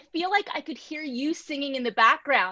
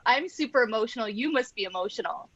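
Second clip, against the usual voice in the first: ordinary delivery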